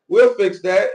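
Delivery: mock his breezy promise back at him; mocking imitation. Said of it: "he's saying it like".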